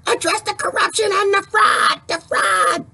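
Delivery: high, screaming impersonation; hammed-up shrieked catchphrase; Debbie yelling. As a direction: yell it high, mimicking her battle cry. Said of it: "she's yelling and screaming".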